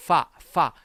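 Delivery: "파, 파"를 영어 F처럼 바람이 새게; 파, 파를 한 음절씩 길게, F 소리로 시범. Shown as a demonstration. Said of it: "발음이 새는 소리를 내주셔야겠죠". (바람 새는 F로 천천히 파, 파)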